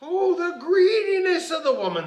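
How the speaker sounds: high, loud leprechaun outrage; hammed-up indignant screech; mock scream. A narrator's reading. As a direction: cry out as an outraged little man. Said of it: "screamed the little man".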